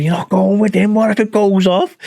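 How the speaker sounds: a hint of an anxious mate pleading; mock worried mate. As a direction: act out the mate's anxious protest. Mate quoted fussing over a fight.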